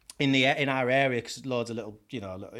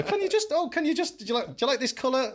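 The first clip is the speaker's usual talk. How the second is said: high, fussing customer voice; mock fussy customer; 10 semitones higher than usual. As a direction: imitate a picky client, fast at the end.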